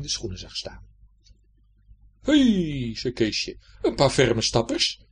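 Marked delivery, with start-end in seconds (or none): 2.2-5.0 put-on slow, loud, pleased boy; Keesje's voice. Keesje spots the shoes by the bin, slow and pleased. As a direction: savour a lucky find.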